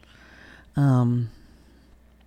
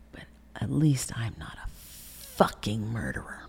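cold hushed retort. Retold or imitated.

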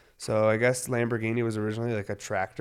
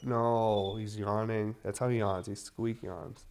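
usual speaking voice

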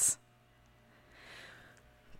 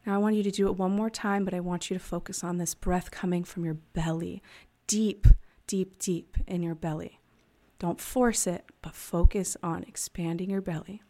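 guided breathing; 4 dB louder than usual. Acted. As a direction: talk them through each breath, unhurried. Leads a breathing exercise.

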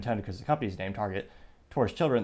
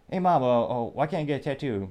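put-on higher, louder whiny child's plea; pestering kid; 4 semitones higher, 4 dB louder than usual. Kid pestering mom for a tattoo, whiny and higher. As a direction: play the nagging little kid. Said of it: "a little kid goes up to the parent".

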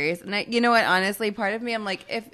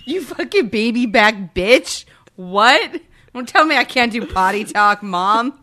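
loud, higher mock-defiant child; sassy kid; 5 semitones higher, 8 dB louder than usual. Co-host riffing as the child defying mom, mock-outraged and loud. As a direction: play a mouthy kid, loudly outraged.

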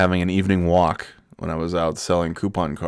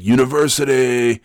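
intense coworker impression; 6 semitones higher, 5 dB louder than usual. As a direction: holler it like the chain-smoking salesman. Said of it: "and then he was like".

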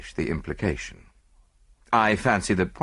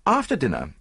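ordinary delivery

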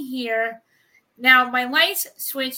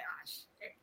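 whispered; 22 dB quieter than usual. Retold or imitated. Retold outage reaction.

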